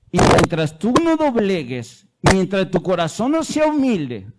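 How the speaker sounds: booming, slowed preacher's exhortation; fervent preaching. Real, not acted. Preacher warning a doubting student, booming and fervent. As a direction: press it on them with full force from the pulpit.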